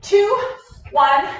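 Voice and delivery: loud countdown call; barking gym coach. Real, not acted. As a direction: bark the count to the class.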